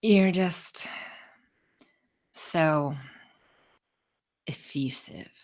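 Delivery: slow, fading, whispered verdict; pointed verdict. Retold or imitated. Boss delivering her slow, damning verdict. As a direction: drop it slowly down to a whisper. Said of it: "she goes".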